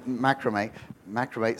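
"macrame" said MACK-ruh-may; low, soft "macrame", stressed on MACK; short a up front in "macrame"; first-syllable macrame. Shown as a demonstration. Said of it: "God can heal your languages".

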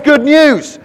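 loud, high herald's cry; angel's proclamation. Retold or imitated. Angel comes bringing news, loud high proclamation. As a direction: proclaim it to everyone.

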